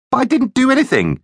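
very high, wailing protest; protesting innocence. Acted. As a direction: plead that you are wrongly blamed. Joe protests his innocence.

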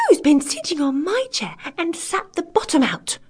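high little indignant bear voice; angry teeny tiny bear. A narrator's reading. Littlest bear finds chair broken, high angry squeak. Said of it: "in a teeny tiny voice of anger".

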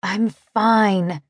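"fine" stretched long; word drawn out. A narrator's reading. Faint woman insisting to cowboy, drawing "fine" out. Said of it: "drawing the word out".

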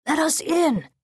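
high, urgent call; shouted demand. Acted. Bodyguard shouting to sentries at the castle gate, high urgent demand. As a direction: shout for entry, pitch leaping up. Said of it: "Lieutenant Asukai shouted".

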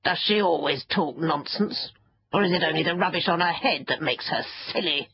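lightly voiced scornful fairy creature; mild disdain, talking about her as if absent. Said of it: "It looked scornfully".

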